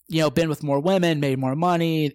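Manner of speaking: ordinary talk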